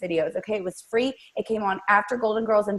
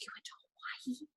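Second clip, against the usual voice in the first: hushed, wonderstruck whisper; barely audible retold amazement; awed whisper; 4 semitones higher, 24 dB quieter, much faster than usual. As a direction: whisper it as if Hawaii were a mythical place. Awed reaction to magical Hawaii.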